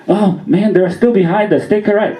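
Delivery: high-pitched mock panic; panicky cousin. Cousin warns the driver about cops behind, mock-panicked. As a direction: act out a worried passenger, voice jumping up.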